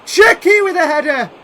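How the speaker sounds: loud, high burst on the header; excited shout. Real, not acted. Gamer yelling at a late header chance, loud shout. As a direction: shout it as the cross arrives.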